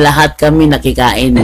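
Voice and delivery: normal talking voice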